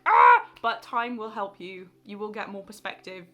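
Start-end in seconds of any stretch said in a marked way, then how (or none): 0.1-0.5 sudden, very high, loud mock scream; frustrated scream. Viewers screaming at hearing about time again, hammed-up. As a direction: shriek in mock exasperation. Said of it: "you're probably like".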